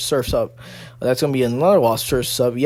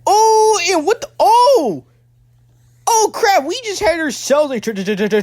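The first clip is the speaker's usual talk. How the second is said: very high, loud cries of disbelief; astonished shouting; 13 semitones higher, 4 dB louder than usual. Real, not acted. Commentator witnessing a multiple takedown, astonished shouting. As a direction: yell out as the pile-up happens.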